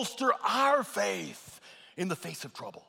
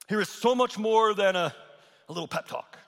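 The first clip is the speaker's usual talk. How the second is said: ordinary talk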